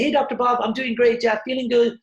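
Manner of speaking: mock a salesperson faking that all is great; fake cheerful. Salesperson answering Doctor Bob's call, fake cheerful and high. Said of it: "But inside they're like".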